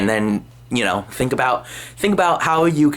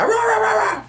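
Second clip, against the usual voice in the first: very high mock roaring chant; hype yell. Speed acting wild in his mom's house, high mock roar. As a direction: bark rah like a hyped streamer. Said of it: "he was all acting like".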